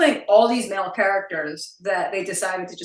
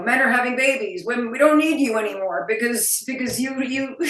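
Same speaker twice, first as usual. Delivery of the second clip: higher, mocking recital breaking into a laugh; mock dismissive quote; 5 semitones higher, 5 dB louder than usual. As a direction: imitate a silly argument, pitch lifted. Voicing men dismissing women.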